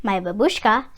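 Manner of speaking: ordinary delivery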